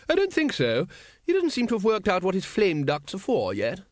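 high lady's voice, breezily informative; hearty aristocratic lady. A narrator's reading. Lady Ramkin reassuring the sergeant about the dragon, breezily.

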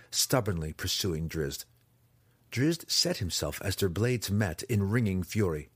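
plain reading voice

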